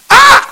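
sudden high loud ah; startled high cry. Acted. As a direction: burst out in delighted surprise. Burst at manna falling.

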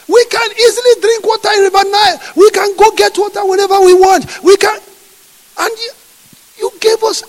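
high, loud, near-tearful shouted complaint; furious complaining. Acted. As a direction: scream at God over bitter water. Thirsty Israelites complain to God.

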